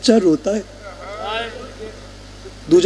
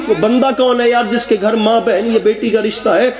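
usual speaking voice

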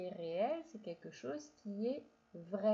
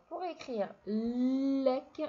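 slow, louder explaining; 2 semitones higher, 6 dB louder, much slower than usual. Shown as a demonstration. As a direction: spell it out slowly. (explication lente et appuyée)

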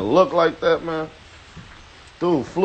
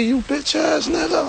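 high, quiet mocking drunk voice; mock drunk insult; 13 semitones higher, 5 dB quieter than usual. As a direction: sneer it like the drunk guy.

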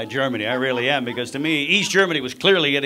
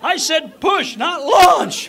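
much higher, louder alarmed yell; startled doctor; 12 semitones higher, 6 dB louder, much slower than usual. Retold or imitated. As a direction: cry out as the baby flies past. Doctor after baby is launched.

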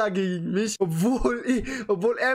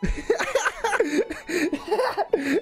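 high burst of laughter; long high-pitched laugh; 9 semitones higher than usual. Real, not acted. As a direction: explode into giggles. Laughs after hang-up.

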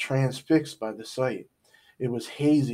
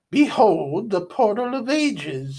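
higher, louder, slow declaration; grand villain proclamation; 9 semitones higher, 6 dB louder than usual. A narrator's reading. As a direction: proclaim it to the whole hall. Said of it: "said Salamandra".

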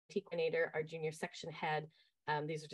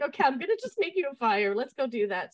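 eager to help; 8 semitones higher, 9 dB louder than usual. Retold or imitated. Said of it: "And I was like".

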